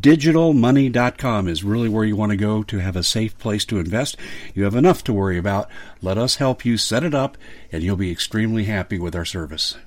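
upbeat pitchman voice selling gold and crypto; ad read. Acted. Radio host reading a sponsor ad to listeners, punchy pitch. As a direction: sell it like a radio spot.

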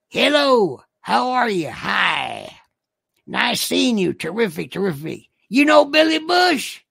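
exaggerated Southern voice, big pitch swings; Southern affectation. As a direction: greet them in a hammed-up Southern accent. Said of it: "I get to read it in this voice".

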